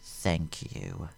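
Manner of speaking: very soft, low cold thanks; quiet menace. A narrator's reading. Vader thanking the dead Wat Tambor, very soft. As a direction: murmur it coldly.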